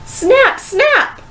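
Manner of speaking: very high, sharp snaps; excited sound words. A narrator's reading. Narrator voices bursting hair bands, very high. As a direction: snap it out high and bright.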